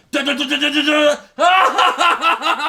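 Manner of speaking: high, loud rat-a-tat gun sounds, shouted; spray the room with mouth gunfire; mock machine gun burst; tommy gun noise. Imitating Tommy gun fire.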